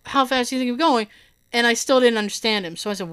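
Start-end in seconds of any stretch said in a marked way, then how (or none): 0.1-1.1 quick, running the words together; rushed repeat. Retold or imitated. Officer repeating the question, mildly rushed. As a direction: fire it off again fast. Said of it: "So now he goes".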